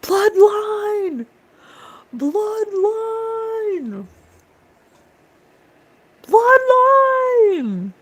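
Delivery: very high, long-held wail; hammed-up sustained falsetto call; high-pitched drawn-out cry. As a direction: stretch the last syllable way up high. Calling out bloodline repeatedly, mockingly.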